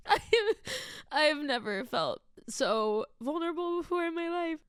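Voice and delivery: real crying; tearful. Real, not acted. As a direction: confess it through tears. Crying over the song.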